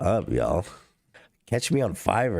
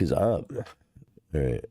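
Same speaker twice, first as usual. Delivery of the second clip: ordinary talk, nothing marked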